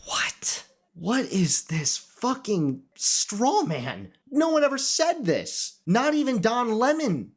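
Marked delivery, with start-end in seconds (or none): 0.0-4.1 slow disbelieving outrage; stunned, drawn out; incredulous. Real, not acted. Disbelief at clip. 4.3-5.8 high exasperated protest; pitched up in frustration. Real, not acted. Exasperated rebuttal.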